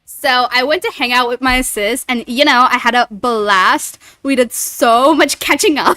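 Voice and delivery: put-on loud, bubbly Valley Girl chatter. Shown as a demonstration. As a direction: gush like a Southern California girl. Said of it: "South California voice is how she's describing".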